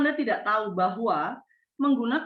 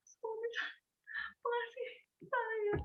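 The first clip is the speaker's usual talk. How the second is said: high, tearful, much softer sob; crying; 12 semitones higher, 13 dB quieter than usual. Shown as a demonstration. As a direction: choke the words out through tears. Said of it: "menangis terseduh-seduh".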